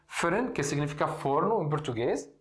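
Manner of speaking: ordinary talk, nothing marked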